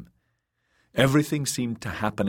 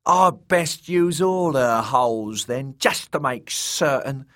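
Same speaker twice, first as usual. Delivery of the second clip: lewd jester, gloating; 7 semitones higher, 4 dB louder than usual. A narrator's reading. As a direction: crow back with relish. Jester answers with lewd boast.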